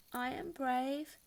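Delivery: slow, shaky-sounding, not quite believing; unsure self-reassurance. A narrator's reading. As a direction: try to talk yourself into it. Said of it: "tried to convince herself".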